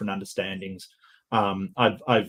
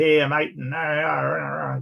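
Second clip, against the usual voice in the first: higher, drawn-out ocker Aussie twang; twangy Aussie drawl dissolving into mumbled noises; twangy ocker Australian accent; 6 semitones higher, slower than usual. Shown as a demonstration. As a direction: caricature a broad Australian accent, chewing the words. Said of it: "the real twangy kind of accents".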